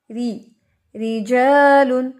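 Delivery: रिजालुन with a heavy, stressed री; wrong heavy r. Shown as a demonstration. Teacher shows students the wrong reading. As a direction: demonstrate the incorrect reading. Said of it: "रिजालुन नहीं पढ़ेंगे हम".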